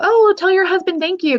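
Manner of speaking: high, chirpy mock of a clueless stranger. Mocking strangers who thank her husband, in a high chirpy voice. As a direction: mimic a well-meaning but clueless stranger.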